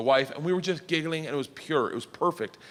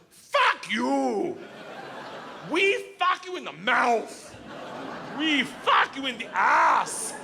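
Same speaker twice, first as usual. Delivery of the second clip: Igor yelling; 8 semitones higher, much slower than usual. Retold or imitated. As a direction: bellow it at the cop like a gangster. Igor yelling at the cop, retold.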